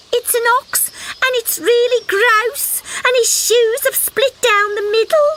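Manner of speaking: high little frog voice, eager and breathless; a small frog bursting with news; excited little frog. Acted. Frog describing ox.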